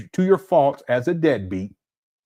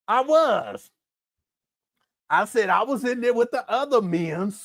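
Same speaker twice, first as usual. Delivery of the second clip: lightly voiced mocking impression of the father, a bit higher; mock Umar voice; 4 semitones higher than usual. Host mocks the absent father's excuses, in a light mock voice. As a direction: play the lying dad bragging, half-hammed. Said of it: "Umar gonna be up here talking about".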